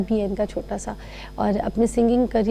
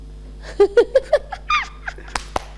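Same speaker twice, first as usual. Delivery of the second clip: high breathless laugh. Real, not acted. Laughs at his girl voice.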